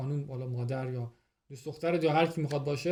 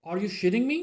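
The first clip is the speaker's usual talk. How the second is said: louder disbelieving "are you shitting me?"; disbelieving surprise; 4 semitones higher, 6 dB louder than usual. Acted. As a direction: speaker B can't believe Robert won. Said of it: "So he doesn't believe it".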